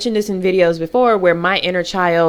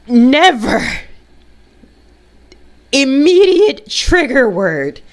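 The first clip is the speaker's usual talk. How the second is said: louder, slow, weighty declaration; emphatic; 6 semitones higher, 4 dB louder, much slower than usual. Real, not acted. Telling viewers the forbidden word, emphatic and slow. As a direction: land each word hard and slow.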